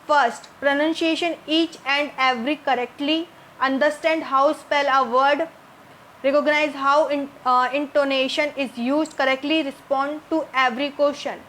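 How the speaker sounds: slow, word-by-word reading of the English options. Shown as a demonstration. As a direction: read each option slowly and clearly for the class. Reads the answer options aloud. (अंग्रेज़ी विकल्प धीरे-धीरे पढ़कर सुनाना)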